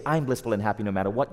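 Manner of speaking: quick, tossed-off affirmation; declaration, not question. Shown as a demonstration. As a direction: rattle it off as a flat statement of fact. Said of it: "you don't say".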